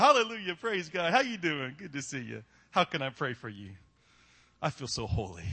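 mock-pious churchgoer, sinking to a low voice; fake holy greeting. Family-cusser greets fellow churchgoers minutes later, fake holy cheer. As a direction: put on a showy holy front.

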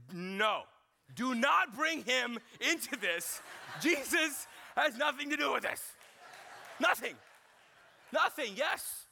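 loud, high mock shouting; hammed-up indignant yell, punching each phrase; outraged dad. Dad tells son not to bring Jesus in, outraged shout. Dad's acted outburst at son's argument.

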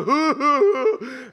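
high, loud mock wailing hoos, then fading off; hammed-up bawling, then trailing away; imitated sobbing. Pastor mimicking the man's wailing on the plane. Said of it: "And he starts bawling".

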